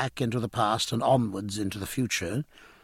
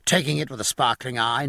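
usual speaking voice

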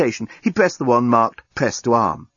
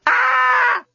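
sustained, piercingly high scream; high-pitched scream; 3 dB louder than usual. A narrator's reading. Lucy decides to scream at her predicament, high scream. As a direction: let out one long, high scream. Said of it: "decided that a good scream".

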